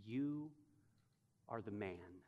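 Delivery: low and soft, pauses between words; almost a hushed verdict; the Lord's quiet accusation. Shown as a demonstration. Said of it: "he now hears two more from the Lord".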